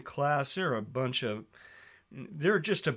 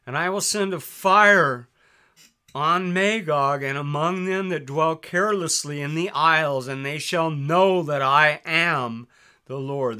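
raised, louder preaching read of the Bible; scripture reading; 6 dB louder than usual. Acted. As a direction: proclaim the verses like a preacher.

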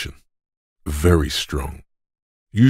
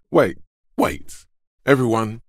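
higher-pitched call to stop; urgent halt; 7 semitones higher than usual. Acted. Calling on everyone to just wait, urgent halt.

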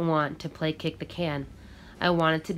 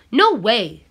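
loud, higher flat refusal; shouted; 5 semitones higher, 10 dB louder than usual. Acted. Carlos shouts his refusal at Manuelito, loud. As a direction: shout him down. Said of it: "Carlos shouted".

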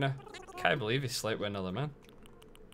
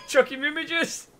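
much higher, laughing through the silly name; laughing squeal; 5 dB louder than usual. Real, not acted. Streamer repeating her silly drink name, squealing with laughter. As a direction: giggle it out.

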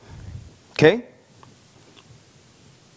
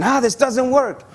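higher, fed-up complaint; frustrated quitter; 7 semitones higher than usual. Retold or imitated. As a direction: throw up your hands and quit. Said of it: "on the verge of saying".

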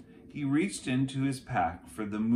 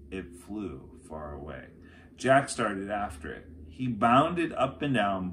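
ordinary delivery

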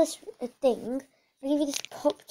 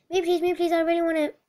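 louder, higher pleading; eager begging; 4 semitones higher, 7 dB louder, faster than usual. Acted. Buyer begging at the auction, eager and louder. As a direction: jump in keen and loud.

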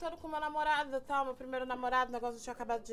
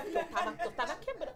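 usual speaking voice

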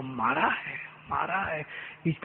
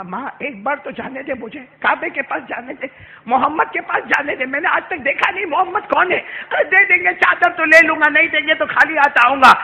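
pleading; 8 semitones higher, 12 dB louder than usual. Acted. Boy pleading to go.

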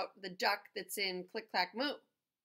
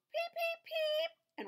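squeaky duckling peeps; much slower than usual. Acted. Reading to a child, duckling peeping squeakily. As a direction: peep like a tiny duckling.

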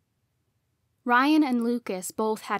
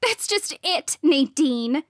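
high, heated outburst; angry high-pitched cry; screamed; 5 semitones higher, 6 dB louder than usual. A narrator's reading. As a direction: raise your voice in exasperated fury. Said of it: "she screamed".

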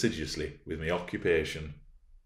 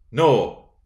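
loud, raised no; firm, louder refusal; insistent refusal; 4 semitones higher, 8 dB louder than usual. Acted. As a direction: refuse to give way. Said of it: "she persisted".

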